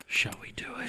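breathy unvoiced whisper. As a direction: whisper it like a secret plan. Whispered mock romance.

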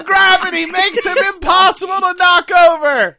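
excited shout. Acted. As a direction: bellow it with glee.

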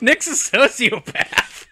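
high, slow, laughing tease; laughing accusation. Real, not acted. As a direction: draw it out while cracking up. Teasing friend amid group laughter.

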